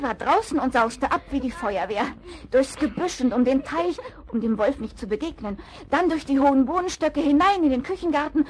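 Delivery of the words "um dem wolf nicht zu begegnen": ordinary talk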